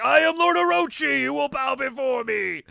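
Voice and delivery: loud, high booming proclamation; booming demon lord. Retold or imitated. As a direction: command them like a demon lord. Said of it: "maybe with, like, a deeper voice".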